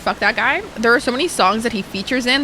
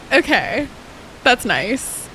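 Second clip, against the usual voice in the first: ordinary talk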